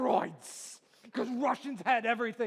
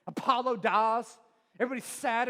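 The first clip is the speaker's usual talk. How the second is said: ordinary talk, nothing marked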